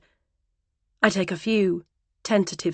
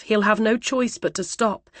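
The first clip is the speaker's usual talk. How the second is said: plain reading voice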